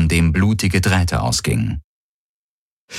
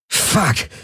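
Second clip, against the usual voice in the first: gasped curse; 10 semitones higher than usual. A narrator's reading. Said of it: "keuchte Galen".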